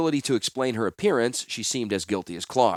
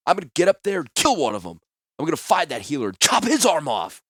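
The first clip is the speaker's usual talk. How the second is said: furious threat; 6 semitones higher, 4 dB louder than usual. Acted. Wounded man raging at captors.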